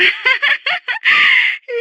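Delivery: high-pitched delighted laugh; high flirtatious giggle. Acted.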